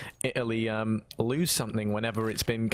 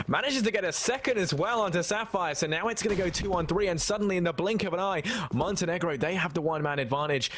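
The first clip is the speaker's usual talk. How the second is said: higher-pitched live esports call; excited play-by-play; 6 semitones higher than usual. Real, not acted. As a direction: call the sudden turnaround with rising excitement.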